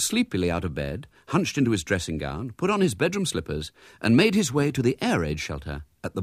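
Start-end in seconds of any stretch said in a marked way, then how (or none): none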